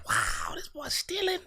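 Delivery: high, slow, hushed disbelief; astonished whisper-gasp. Retold or imitated. Young man catching a deacon stealing offering money, high slow astonishment.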